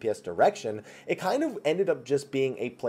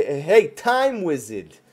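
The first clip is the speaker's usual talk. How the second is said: slow, louder mocking New York accent; Joey Wheeler impression; 2 semitones higher, 5 dB louder, much slower than usual. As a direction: drag the words out in a cocky New York twang. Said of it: "I also like to kind of mimic him".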